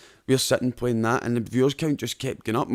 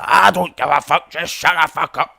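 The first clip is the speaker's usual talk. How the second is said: loud, higher angry shout as his mum; furious mum yelling; 5 semitones higher, 9 dB louder than usual. Retold or imitated. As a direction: bellow it like a mum who has had enough. Mum yelling at him to shut up.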